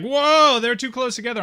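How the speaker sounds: high startled "whoa"; mock alarm. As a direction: gasp at it like a worried producer. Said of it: "everyone's like".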